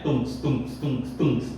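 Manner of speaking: low rhythmic "tungs" like a club beat; electronic music beat. Shown as a demonstration. Teacher jokes it sounds like electronic music, low beat imitation. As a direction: pulse it like a techno thump. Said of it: "Parece aquelas músicas eletrônicas".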